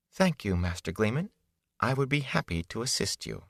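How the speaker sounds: cool politeness hiding anger; too calm. A narrator's reading. Young woman answers the gleeman with icy calm. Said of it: "spoke in a too calm voice".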